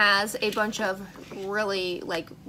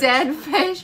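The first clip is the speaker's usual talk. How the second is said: higher, louder dismay; 6 semitones higher, 6 dB louder than usual. Real, not acted. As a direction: groan out the dreaded flavor. Dreaded flavor revealed.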